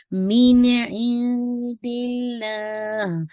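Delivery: slow melodic मिन इंदिल्लाह; tajweed recital; Quran recitation. Shown as a demonstration. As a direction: chant it, clear noon. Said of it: "मैंने इसको रोका था".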